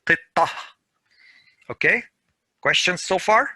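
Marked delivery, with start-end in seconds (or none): 0.0-0.5 Qittah with a final h sound; pausal form. Shown as a demonstration. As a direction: drop the t, breathe out on Qittah. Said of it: "Pronounces like ha".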